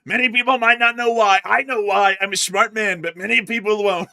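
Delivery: high, loud smug impression; mock him as a smug leader; imitating the president. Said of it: "turning to the president".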